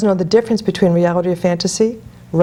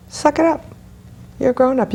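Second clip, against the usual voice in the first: higher-pitched, no-nonsense push; retold tough love; 5 semitones higher than usual. Coach pushing a bullied grown man, mock tough love. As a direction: tell them briskly to toughen up, without shouting.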